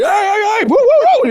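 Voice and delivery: high, loud whooping warning call; street lookout call. Retold or imitated. Teen lookout shouting a warning to the block, high loud whoop. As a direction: holler out to warn the crew down the block.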